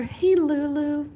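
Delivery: high, slow call to a pet; sweet pet greeting. Real, not acted. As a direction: coo at the little dog.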